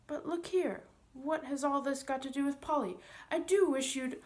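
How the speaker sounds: high, loud urgent pleading; boy demanding answers at once; impatient boy. Acted. Boy pressing impatiently about Polly.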